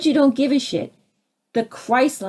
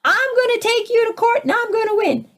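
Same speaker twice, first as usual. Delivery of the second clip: much higher, taunting enforcer voice; mock smug official; 12 semitones higher than usual. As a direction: gloat like a bully in uniform. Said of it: "and says".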